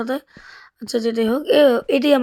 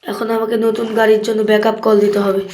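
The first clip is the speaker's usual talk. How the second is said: ordinary talk, nothing marked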